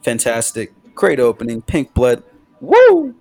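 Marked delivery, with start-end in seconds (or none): 2.6-3.1 high, loud "woo"; whooping with excitement; excited whoop. Real, not acted. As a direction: let out an enthusiastic whoop. Hyped reaction to opening song.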